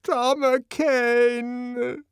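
high, long drawn-out cry; agonized plea. A narrator's reading. Linda holding out her arms to him, agonized. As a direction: wail his name imploringly.

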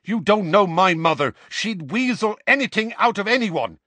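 high, loud snapping retort; snapped. A narrator's reading. Seamus snapping at Dean, high and loud. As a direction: snap it at him. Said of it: "Seamus snapped at him".

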